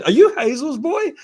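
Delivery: high, laughing question; lightly voiced Terry Jones delighted; delighted recognition. Terry Jones recognizing his mum's son, laughing. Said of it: "And he said".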